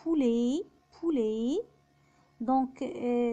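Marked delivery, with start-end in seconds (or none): 0.0-1.6 "بولي" ending in e, t silent; "بولي" twice, rising onto the final e. Shown as a demonstration. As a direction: say French "بولي", poulet, without t. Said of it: "متبوع بحرف التي بيتنطق إي".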